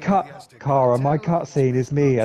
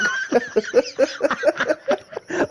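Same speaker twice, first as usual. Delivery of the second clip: a high giggle of real amusement; high laugh; 11 semitones higher than usual. Real, not acted. Laughing at her friend's joke, a high giggle.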